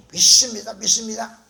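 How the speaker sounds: loud, insistent mock profession of faith; fervent prayer imitation. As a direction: belt it out like a pleading believer. Mimics insistent prayer for college admission. (간절히 부르짖는 기도 흉내)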